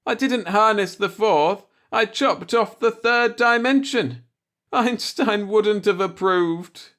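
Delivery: gasping and mumbling. A narrator's reading. Flattened Jack explaining the accident, gasping. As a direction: struggle out each word, shaken. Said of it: "He went on gasping and mumbling".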